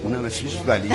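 ordinary talk, nothing marked

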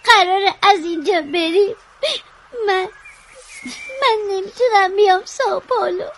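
halting, tearful words with breaks; crying. Acted. Child asking sister about moving away, crying. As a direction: force it out between sobs.

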